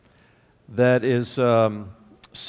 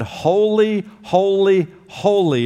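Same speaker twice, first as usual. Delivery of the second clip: raised, ringing voice on the first two holies; ringing proclamation; 5 semitones higher, 4 dB louder, slower than usual. Acted. Preacher voicing the seraphim crying to one another, raised and ringing. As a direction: lift the holies high and loud like angels crying out. Angels crying holy loudly.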